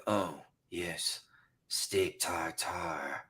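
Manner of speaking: low, slow, breathy romance-novel whisper; seductive vampire whisper. Retold or imitated. Vampire guy purring at the heroine, low slow whisper. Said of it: "the guy says it like this".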